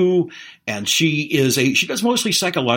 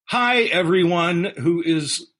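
ordinary delivery

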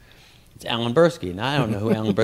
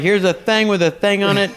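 higher, louder goofy prop-comic voice; mocking the opener; 9 semitones higher, 8 dB louder than usual. Comic mimicking the opener doing his props, goofy and loud. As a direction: ham up the stolen bit. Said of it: "he's like".

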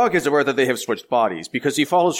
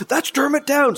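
much higher, louder shout of recognition; recognizing an actor in a movie, excited yell; slower than usual. Retold or imitated. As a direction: call it out like you just spotted him. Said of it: "Like, I yelled it out loud".

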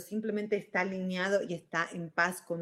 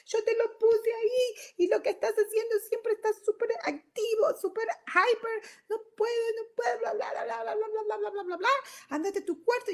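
put-on much higher, strained yelling at a child, near tears; angry shrill scolding. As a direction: scold the kid for spilling juice, voice cracking high. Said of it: "Sale la energía y dice".

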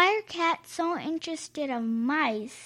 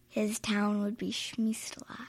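soft whisper; hushed secret whisper; 3 semitones lower, 8 dB quieter than usual. Real, not acted. Child whispers punchline.

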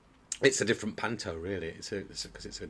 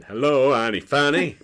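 imitating kids yelling a greeting, louder; kids shouting; 6 semitones higher, 13 dB louder than usual. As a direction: shout it back like a panto crowd. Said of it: "They were just shouting".

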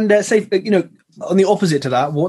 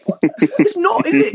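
much higher, laughing disbelief; mock disbelief; 12 semitones higher than usual. Guest retelling his reply to the builder, in laughing disbelief. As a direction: squeak it out in amused shock. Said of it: "I was like".

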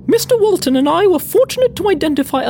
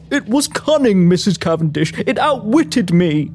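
distressed gentleman; 7 semitones lower than usual. Acted. A colleague begs Missus Cavendish for help, distressed. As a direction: plead in a lower male character voice. Plays Walton explaining the escape.

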